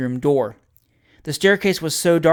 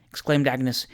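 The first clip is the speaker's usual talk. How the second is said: ordinary delivery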